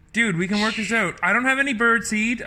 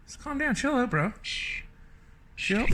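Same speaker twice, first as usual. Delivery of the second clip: much softer, calming the bird; soothing hush; 9 dB quieter than usual. Real, not acted. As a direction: ease it down gently, almost under the breath.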